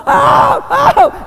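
very high, loud shout of joy; high shouted oh of rapture; ecstatic cry. Pretend. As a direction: let out a wild joyful cry. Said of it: "running around heaven going".

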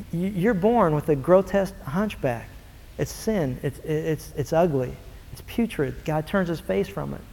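low, hushed, grave judgement; hushed God voice. Acted. God telling the hunchbacked man about his sin, hushed and grave. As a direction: speak of sin with quiet disgust.

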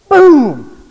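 a loud, high "boom!" like a crash; crash sound effect. Retold or imitated. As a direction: belt out the crash noise. Car crash in joke story.

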